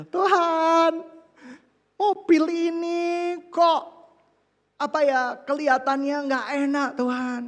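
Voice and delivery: whiny prayer. Retold or imitated. Preacher voicing his complaint to God about his car, high whining. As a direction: beg God like a spoiled child.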